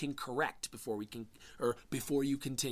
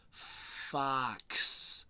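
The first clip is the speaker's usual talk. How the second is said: normal talking voice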